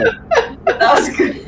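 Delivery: breathless high laugh; laughing hard. Real, not acted. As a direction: squeal it through laughter. Laughing praise after the Welsh bit.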